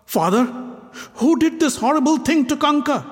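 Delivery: high, worried question; alarmed son asking; anxious. Acted. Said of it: "Anxious, he asked".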